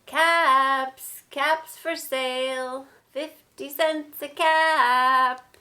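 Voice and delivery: loud, long-drawn peddler's cry; street vendor's call. A narrator's reading. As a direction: call out your wares, stretching the words.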